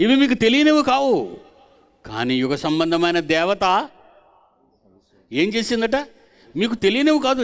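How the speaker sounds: preaching at full force. Acted. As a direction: press the point home to the congregation. (పూర్తి శక్తితో ప్రసంగం)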